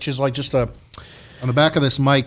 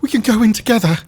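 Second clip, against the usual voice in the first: quoting a character; 8 semitones higher, 3 dB louder than usual. Retold or imitated. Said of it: "the guy started saying".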